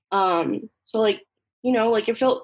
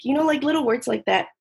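ordinary talk, nothing marked

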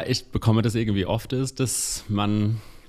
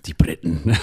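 laughing; 3 dB louder than usual. Real, not acted. Laughing about the British.

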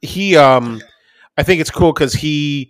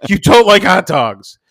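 high, loud, stretched-out disbelief; mock hyperventilating outrage; 6 semitones higher, 5 dB louder than usual. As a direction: imitate a kid losing it over food. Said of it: "starts hyperventilating".

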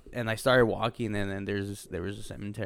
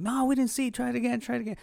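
much higher, mimicked friends; mock high-pitched urging; egging on; 13 semitones higher, faster than usual. Friends urging him to repeat his smoke trick, egging on.

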